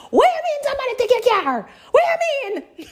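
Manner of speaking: shrieking high, fast disbelief; hysterical mom. Retold or imitated. Mom hearing on the phone her car was stolen, shrill panic. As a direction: mom panicking even harder. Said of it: "She's gonna get more hysterical".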